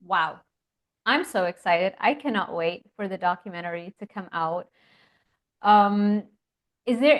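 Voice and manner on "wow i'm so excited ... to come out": ordinary talk, nothing marked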